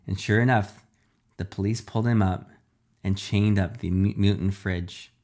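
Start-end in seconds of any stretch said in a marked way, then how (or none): none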